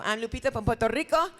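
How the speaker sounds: put-on Lupita from Puerto Rico in a light Puerto Rican accent, Rico as REE-ko. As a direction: introduce yourself as Lupita with a Spanish lilt. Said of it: "that's my other character".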